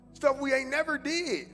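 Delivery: ordinary talk, nothing marked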